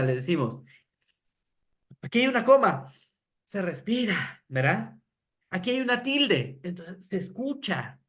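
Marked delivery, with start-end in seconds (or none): 2.0-2.9 "coma" marcada, más aguda y fuerte; lectura exagerada. Shown as a demonstration. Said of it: "lo que hacemos es exagerar". 5.5-6.6 "tilde" remarcada, tono más agudo; acentúa bien la "tilde"; lectura exagerada. Shown as a demonstration. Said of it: "lo que hacemos es exagerar".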